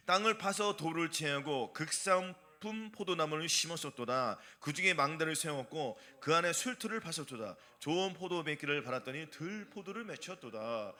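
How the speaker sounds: low, measured Bible reading; scripture reading. Real, not acted. Preacher leading the congregation in reading Isaiah, solemn recitation. Reads the scripture passage aloud.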